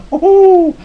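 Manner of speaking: high, loud whoop; excited whoop. Retold or imitated. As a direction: whoop it out in amazement. Retold amazed whoop at horse.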